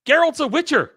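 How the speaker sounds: loud, high, indignant insistence; nearly shouting in protest; emphatic outburst. Real, not acted. Insisting Geralt never changes.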